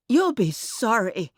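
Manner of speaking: high, distinct warning; said distinctly. A narrator's reading. Miss Harper warns the bus driver, speaking distinctly. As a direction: pitch it up and say each word distinctly. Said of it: "Miss Harper said distinctly".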